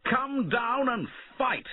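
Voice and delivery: high, slow call to battle; defiant rebel calling the king down, high and slow; challenging shout. A narrator's reading. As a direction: bellow up at the castle walls. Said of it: "he shouted".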